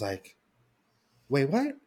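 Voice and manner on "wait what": jumps way up in pitch, disbelieving; mom's stunned double take, shrill and rising; a mom hearing the pregnancy news, stunned; shocked disbelief. Retold or imitated. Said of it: "she starts flipping out".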